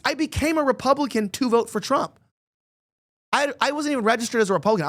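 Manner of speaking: normal talking voice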